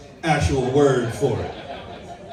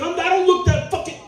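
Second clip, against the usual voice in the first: mock indignant protest; 12 semitones higher than usual. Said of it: "I was gonna be like".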